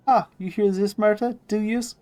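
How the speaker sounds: high-pitched, mocking old seamstress; riled crone. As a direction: pitch it way up, taunting the haunting corpse. Marta riled by the haunting threat.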